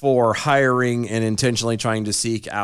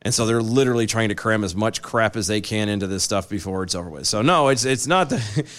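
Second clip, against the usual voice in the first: normal talking voice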